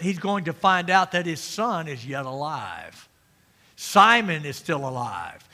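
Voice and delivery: ordinary talk, nothing marked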